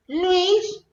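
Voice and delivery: very high, softened echo of a call; mock faint calling. Calling the boyfriend Luis a second time, high and soft. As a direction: repeat the name in a small, high put-on voice.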